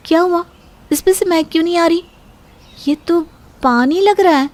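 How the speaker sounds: high, fast puzzled alarm; surprised. Acted. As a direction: sniff the bottle, startled it's water.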